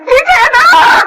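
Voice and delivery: high panicked sobbing; frantic crying. Acted. Deluded mother searches for her missing baby, frantic.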